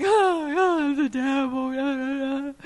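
drawn-out, hammed-up sobbing wail; slow, exaggerated crying over the words; mock crying. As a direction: pretend to blubber with self-pity. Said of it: "God corrected me".

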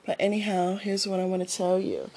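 usual speaking voice

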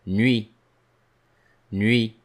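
teacher stresses nuit. Shown as a demonstration. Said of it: "present in the word nuit".

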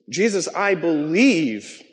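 slow, hushed, reverent words of faith; reverent prayer. Retold or imitated. Preacher quoting a professor's prayer, slow and reverent. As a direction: let each word of the prayer land slowly. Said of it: "and it says this".

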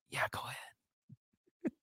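slow guilty whisper. Retold or imitated. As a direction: whisper it like a secret. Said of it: "Here you whisper".

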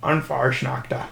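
a soft, slow Irish accent on An Farsnachter. Shown as a demonstration. Asked by a friend to say it in an Irish accent. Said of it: "say it in your Irish accent".

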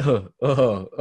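bursting into giggles; laughing. Real, not acted. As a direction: laugh helplessly. Laughing at squeaking nose.

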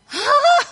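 high, loud gasping yell; mock shriek. Husband seeing wife's new hair, mock shriek. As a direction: imitate a horrified "hah" shriek. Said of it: "dia teriak".

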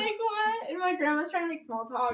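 plain reading voice

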